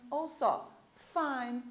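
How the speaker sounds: usual speaking voice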